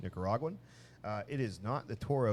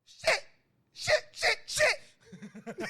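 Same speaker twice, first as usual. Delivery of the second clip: very high, loud shrieking curse; panicked kid swearing; 7 dB louder, much slower than usual. Retold or imitated. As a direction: scream it like a scared little boy. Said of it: "just goes".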